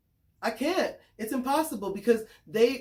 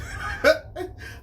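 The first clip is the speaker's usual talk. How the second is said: a burst of high, airy laughter; high breathless laugh; 9 semitones higher than usual. Real, not acted. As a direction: laugh it off, almost crying with it. Laughs after apologizing.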